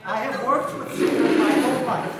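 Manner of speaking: hammed-up shouted reassurance; mock alarm control. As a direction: boom it out to calm a panicking audience. Calming crowd over singer's loud outburst.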